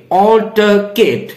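"Altercate" slowly, opening on aw; AW-ltuh-kate. Shown as a demonstration. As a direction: say "Altercate" as awl-tuh-kate, R silent. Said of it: "not altercate".

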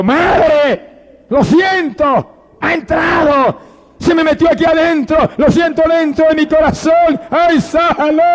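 high, screaming cry to mother; desperate wailing howl; anguished shout. Acted. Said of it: "Le gritó".

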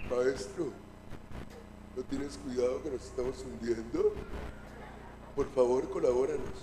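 very soft, low polite plea; hushed, over-courteous request; elegant, refined. Acted. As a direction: ask meekly, almost under the breath. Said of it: "palabras lindas y elegantes".

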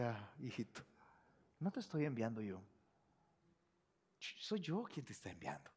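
very soft, low and hushed; near-whisper comfort; tender Jesus. Acted. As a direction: whisper love to a little child.

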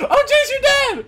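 high, slow laughing yell; laughing shout. Real, not acted. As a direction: laugh it out loud. Friend killed, laughing.

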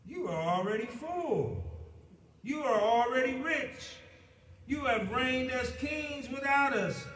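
a touch of mocking flattery; sarcastic. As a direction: lay the sarcasm on thick. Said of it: "Paul says sarcastically".

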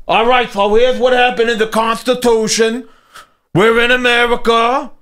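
high, monotone New York caricature; mock New York accent. Host mocking someone explaining the Constitution, New York accent. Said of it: "somebody talking like that".

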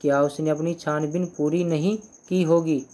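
ordinary talk, nothing marked

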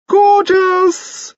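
shrieked high and loud over the band; very high squeak. Acted. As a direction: pitch it right up to top a noisy band. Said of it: "pitching his voice very high".

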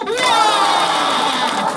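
long, loud, high cry of wonder; an over-the-top wow to make the kids laugh; drawn-out amazed wow. Shown as a demonstration. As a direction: pour huge delight into a stretched wow. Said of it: "시작!".

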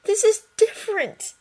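put-on boy insisting through tears. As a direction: push back, upset and urgent. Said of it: "he insisted".

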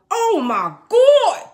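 high, loud, drawn-out gasp of awe; star-struck fan. Retold or imitated. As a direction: squeal it like a fan meeting an idol. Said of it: "you know".

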